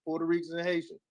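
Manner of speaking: usual speaking voice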